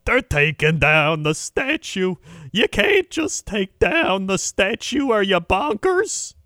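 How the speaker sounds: put-on high, slow, loud indignant old codger; hammed-up cranky old man protesting; outraged old man. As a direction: play a furious bald old man ranting. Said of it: "He's like".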